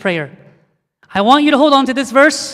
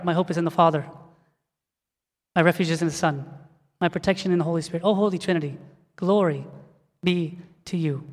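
reverent prayer recitation; 4 semitones lower, 7 dB quieter than usual. Acted. Preacher leading church in a short prayer, soft reverent recitation.

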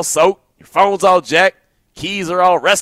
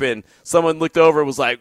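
ordinary talk, nothing marked

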